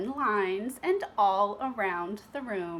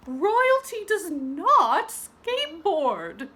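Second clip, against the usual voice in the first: slow, high, loud prim rebuke; stern teacher; 8 semitones higher, 7 dB louder, slower than usual. Acted. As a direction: stress on "not", very proper. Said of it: "called her teacher".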